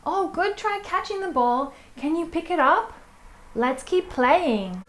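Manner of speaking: encouraging praise. Shown as a demonstration. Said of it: "I might say".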